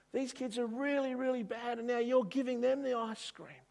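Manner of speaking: high, indignant whine; jealous boy complaining to the shopkeeper, high and whiny. Acted. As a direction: protest like a jealous child. Boy protests to shop owner.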